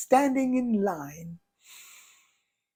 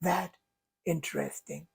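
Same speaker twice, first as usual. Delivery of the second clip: softer, fading to a hush on "interesting"; calm curiosity; 3 semitones lower, 7 dB quieter than usual. Shown as a demonstration. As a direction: soothe yourself with a quiet question. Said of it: "change that to".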